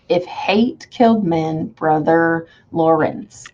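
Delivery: "If hate killed men, Brother Lawrence" in slow even feet; four-beat scansion. Shown as a demonstration. Teacher counting four feet aloud, slow and measured. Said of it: "I heard that four times".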